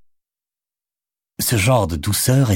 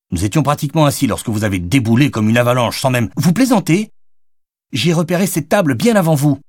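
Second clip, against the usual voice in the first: high, raised angry protest peaking on vous plaisantez; angry outburst; 7 semitones higher, 4 dB louder than usual. A narrator's reading. Madeleine arguing over the table with Jonathan, high and angry. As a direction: let her anger climb to disbelief. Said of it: "La colère de la jeune femme".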